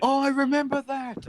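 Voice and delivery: loud burst of sudden memory; excited recognition. Real, not acted. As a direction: blurt it out as it hits you.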